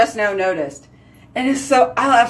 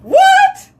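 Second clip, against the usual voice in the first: much higher, louder squeak; shocked squeal; 8 dB louder than usual. Real, not acted. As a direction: squeal in disbelief. Shocked reaction to comment.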